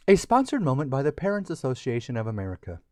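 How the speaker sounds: fast tongue-in-cheek announcement; mock sponsor tag. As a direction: rattle it off like an ad's small print.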